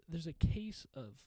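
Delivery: ordinary talk, nothing marked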